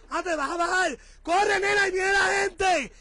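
loud, high, urgent calling out; urgent shout. Real, not acted. Husband yelling at wife to hurry off the road, urgent shout. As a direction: yell at her to hurry before cars come. Urging wife to run from traffic.

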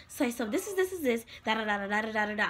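rattling through it too fast; mock rushed classmate; fast explaining. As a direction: gabble the explanation like a rushed tutor. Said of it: "She was like".

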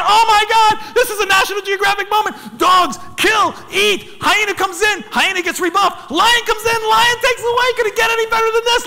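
high-pitched, loud amazement; over-the-top thrilled storytelling; ecstatic retelling. Retold or imitated. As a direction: punch out each event, climbing with excitement. Relives his mounting amazement.

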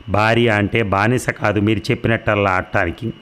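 ordinary delivery, nothing marked

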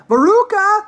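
high, loud call; shouted. Acted. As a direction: call out for your lost daughter. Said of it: "shouted Missus Salt".

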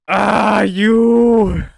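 loud, drawn-out high exclamation; frustrated outburst. Real, not acted. Player yelling at the game after a failed jump, frustrated.

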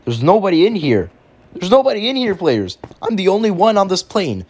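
high, rising alarm; hammed-up worried exclaiming; alarmed discovery. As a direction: play up the dawning panic. Finds the plane empty.

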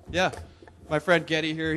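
ordinary delivery

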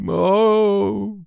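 high, loud theatrical groan. A narrator's reading. Sick boy groaning at his roommate's gloomy words, high groan. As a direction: let out a pained dramatic groan. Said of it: "groaned George".

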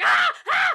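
short repeated yells of rage; acted frustrated screams. Acting out the shooter throwing the gun, frustrated screams. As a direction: scream it out in bursts.

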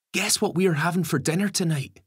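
put-on high, excited boy's voice. A narrator's reading. Ben rushing up to Gary about dinner, high and excited. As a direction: burst out with it, high and eager. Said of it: "he says excitedly".

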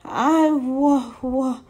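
high, halting little bear voice; nervous stammer. A narrator's reading. Little bear answers big raccoons blocking his path, nervous stammer. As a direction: stammer and stall on the words, pitched high.

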